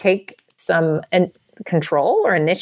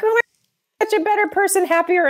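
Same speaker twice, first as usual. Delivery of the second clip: high, airy mock delight; a lightly hammed-up, much happier former student; gushing relief; 13 semitones higher, faster than usual. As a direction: gush like you've finally escaped. Imitating your post-school self.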